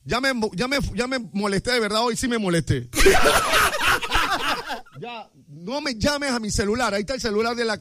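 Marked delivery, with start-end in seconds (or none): 2.9-4.9 big high laugh breaking out; loud burst of laughter. Real, not acted. Host bursting out laughing at his own rant. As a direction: crack up at your own complaint.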